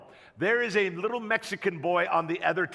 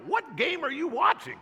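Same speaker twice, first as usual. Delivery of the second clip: higher, disbelieving question; incredulous; 6 semitones higher than usual. Retold or imitated. Dad asks the losing coach, higher incredulous. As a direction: ask it like you can't believe your eyes. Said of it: "And I'm like".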